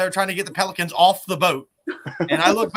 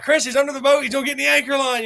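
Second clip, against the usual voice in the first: urgent shout; 7 semitones higher, 5 dB louder, faster than usual. Retold or imitated. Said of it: "and I'm like".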